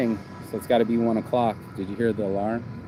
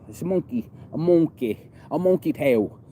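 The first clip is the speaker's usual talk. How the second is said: playful imitated New Zealand accent, pitched up; 5 semitones higher, 3 dB louder than usual. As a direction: repeat it in a higher mock New Zealand accent. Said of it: "It's a New Zealand accent".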